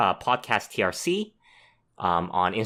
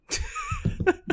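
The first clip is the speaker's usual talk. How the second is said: high-pitched laugh; 3 dB quieter than usual. Real, not acted. Laughs at retake request.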